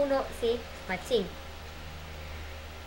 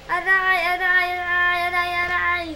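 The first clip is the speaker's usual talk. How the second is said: higher, louder sing-song ouch; monkey hurt by thorns; sung ouch cries; 6 semitones higher, 5 dB louder than usual. Acted. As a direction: wail in pain like a cartoon. Matsing steps on thorns.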